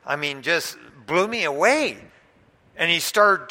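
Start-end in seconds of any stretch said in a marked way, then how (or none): none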